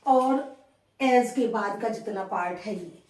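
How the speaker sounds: usual speaking voice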